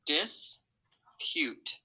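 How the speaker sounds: "Dispute" with a long break after dis; syllable by syllable. Shown as a demonstration. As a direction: sound out "Dispute" as dis, pute. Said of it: "think about the syllables we hear".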